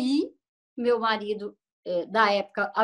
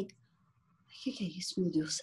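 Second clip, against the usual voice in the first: much softer, slow frightened question; patient softly panicking in the ICU; scared murmur; 7 semitones lower, 13 dB quieter than usual. Retold or imitated. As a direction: hush it as if waking in dread.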